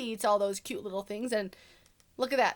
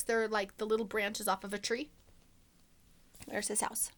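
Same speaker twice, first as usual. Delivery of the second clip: ordinary delivery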